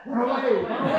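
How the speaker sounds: high, loud cartoon dog voice; a lightly voiced cartoon dog, high and loud; dog's warning. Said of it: "The dog says".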